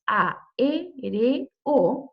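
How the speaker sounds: "A, E, R, O" as four slow separate beats, a-é-re-o; split into syllables. Shown as a demonstration. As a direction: pause after each syllable of "A, E, R, O". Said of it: "así se dice, así se separa".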